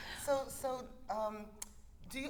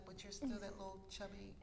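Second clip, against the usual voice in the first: quiet inner voice; 9 dB quieter than usual. Retold or imitated. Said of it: "she'll be like".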